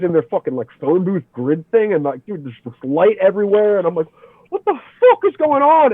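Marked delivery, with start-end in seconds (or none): 4.5-5.9 high frantic cry of alarm; panicked. Retold or imitated. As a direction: blurt it out in sheer panic. Panics at end-of-world vision.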